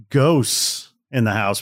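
ordinary talk, nothing marked

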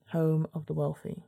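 usual speaking voice

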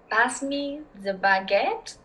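slow, drawn-out French accent; hammed-up French accent on a stock phrase. Shown as a demonstration. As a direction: put on a French accent, unhurried. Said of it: "tried to do a French accent".

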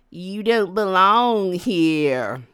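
low, slow, drawn-out warning; menacing stranger. Retold or imitated. Man by restroom warns storyteller off, slow low menace. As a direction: imitate an ominous backwoods local.

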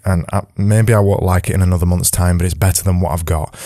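ordinary delivery

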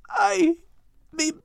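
high, faltering, halting voice; broken words. Acted. Dying Max straining to speak to Kate, high faltering broken words. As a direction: struggle out each word, barely able. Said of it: "spoke with broken words".

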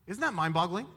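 ordinary talk, nothing marked

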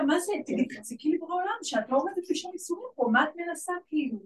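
reenacting how she told off her neighbour, exasperated; exasperated scolding. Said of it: "אמרתי לה".

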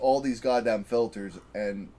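ordinary talk

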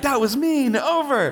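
put-on high, hurt little girl, laughing through it; imitating his daughter. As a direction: squeal it like an offended child. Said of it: "She's like".

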